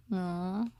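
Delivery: plain reading voice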